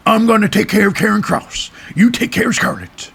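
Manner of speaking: high put-on voice of AJ Styles; AJ Styles impression. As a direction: ham up a cocky wrestler boss. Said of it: "He didn't quite say it like that".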